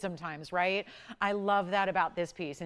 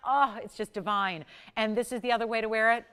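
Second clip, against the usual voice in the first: usual speaking voice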